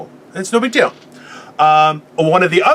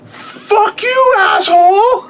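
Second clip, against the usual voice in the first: mock furious yell; 14 semitones higher, much slower than usual. Husband mock-yelling at wife over lost fabric, shrieking. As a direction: shriek it like a cartoon meltdown, half laughing. Mock outburst at wife's trivial worry.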